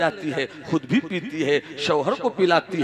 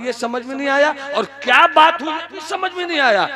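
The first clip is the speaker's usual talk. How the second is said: mounting frustration; 11 semitones higher, 8 dB louder, faster than usual. Acted. Husband frustrated confusion.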